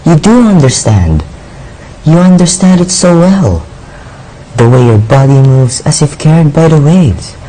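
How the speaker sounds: loud, impassioned wonder; awed pleading. Acted. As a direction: beg ardently, swept away by admiration. Youth marvels, begs another dance.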